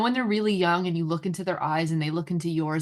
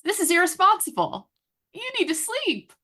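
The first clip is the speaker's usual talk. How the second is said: high, climbing mock disapproval; judging listener; 11 semitones higher than usual. Friend voicing listeners who judge the mother, mock scolding. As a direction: scold in a pitched-up critic's voice. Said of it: "let me just tell you what they're thinking".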